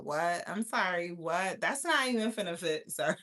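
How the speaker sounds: ordinary talk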